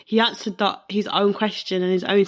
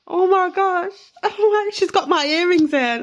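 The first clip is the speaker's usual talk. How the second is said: much higher and louder, laughing in disbelief; overwhelmed, laughing; 9 semitones higher, 8 dB louder than usual. Real, not acted. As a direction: burst out with real emotion and a laugh. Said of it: "I'm so emotional right now".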